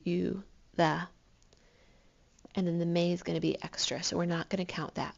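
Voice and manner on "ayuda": Ayuda with the YU louder; stress on YU. Shown as a demonstration. Teacher to class, retrying the demo with clear stress. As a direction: hit the YU of Ayuda, a clean retry. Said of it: "Let me try again".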